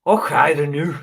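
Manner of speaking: loud, hammed-up Scottish burst; bad Scottish accent. Shown as a demonstration. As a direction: attempt a Scottish accent, badly. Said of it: "Scottish accent. Okay, I've got to get in the mode".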